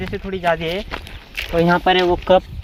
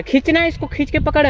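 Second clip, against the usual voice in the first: calling out; 9 semitones higher than usual. Real, not acted. Calling to helper far off.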